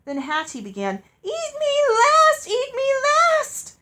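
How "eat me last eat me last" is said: very high, loud, slow shriek. A narrator's reading. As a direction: scream it in terror. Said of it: "she shrieked".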